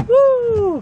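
high excited woo; excited whoop. Real, not acted. Showing viewers a new bell, a high excited woo. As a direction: cheer over the find.

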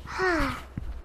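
long, audible acted sigh; bored sigh. The bored shepherd boy sighs.

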